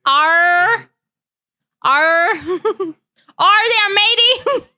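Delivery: playful pirate voice, giggling through it; mock pirate. Streamer jokes to chat about piracy in a laughing pirate voice. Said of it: "I'm not saying to be a pirate".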